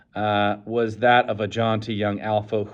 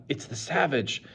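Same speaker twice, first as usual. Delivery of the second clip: softer scared aside; under the breath; 4 semitones higher, 6 dB quieter than usual. A narrator's reading. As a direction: mutter it in alarm. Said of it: "below his breath".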